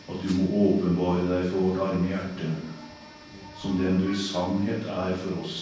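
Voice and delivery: low, soft, hushed prayer. Real, not acted. Preacher praying to Jesus before reading the text, low soft prayer.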